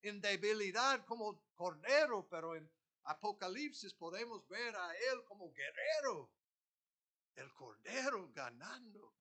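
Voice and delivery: loud, ringing preacher's proclamation; full-force preaching. Acted. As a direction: proclaim it to the congregation. Opening of sermon, preached loudly. (predicación a todo pulmón)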